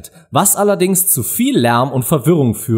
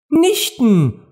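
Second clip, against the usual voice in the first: high, curious exclamation; eager cry; 12 semitones higher than usual. A narrator's reading. Alice hopes to meet new acquaintances, calling out eagerly. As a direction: pipe it out with keen interest. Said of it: "rief Alice, die gern neue Bekanntschaften machte".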